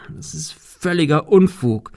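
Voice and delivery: lightly stammered objection; stammering protest. A narrator's reading. Climber calls it utter nonsense, stammering slightly. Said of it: "stammelte er".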